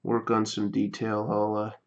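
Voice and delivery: speaking softly, low and hushed; quiet. Real, not acted. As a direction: keep it soft while you focus. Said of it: "Getting quiet".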